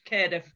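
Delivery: Cardiff said "Kairdiff", as it was written; local Cardiff pronunciation. Shown as a demonstration. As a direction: put an "air" vowel in Cardiff. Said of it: "pronounce Cardiff as he's written it".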